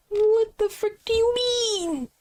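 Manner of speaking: high, slow, breathless whisper of outrage. Acted. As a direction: hiss it out, scandalised and slow. Outraged character reply.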